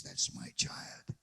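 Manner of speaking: slow, tender whisper; hushed fatherly pride; whispered voice of God. Pretend. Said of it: "God looks down at you and says".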